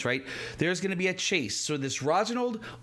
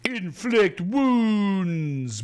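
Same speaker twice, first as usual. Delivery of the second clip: drawn-out, slow, louder villain announcement; slow dramatic villain; 5 semitones higher, 5 dB louder, much slower than usual. Acted. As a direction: announce the spell with slow menace. Villain announcing his spell.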